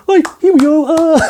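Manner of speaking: high squeaky frog voice; stressed comic slipping into Kermit. Retold or imitated. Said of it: "I go Kermit".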